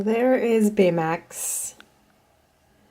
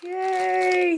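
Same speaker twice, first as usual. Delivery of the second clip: excited squeal; 10 semitones higher, much slower than usual. Real, not acted. Unboxing a wanted toy.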